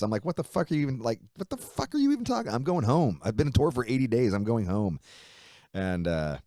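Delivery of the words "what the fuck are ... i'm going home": raised-pitch, indignant outburst retold; pissed off. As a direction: protest in angry disbelief, getting louder. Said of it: "I'm pissed".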